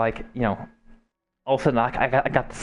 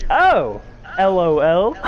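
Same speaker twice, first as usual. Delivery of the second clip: mock girlish reply, higher and louder; a hammed-up quote of her laugh reply; imitating her; 5 semitones higher, 4 dB louder, slower than usual. He voices his crush's startled texted answer.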